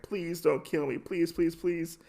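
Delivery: small imitated voice of someone pleading; mock cowering plea. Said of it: "the man is cowering in fear".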